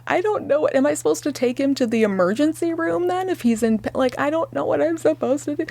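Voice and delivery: high, tearful and desperate; retold crying. Mother crying to nurse on the phone, high desperate. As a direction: plead through held-back tears. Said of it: "basically on the phone crying".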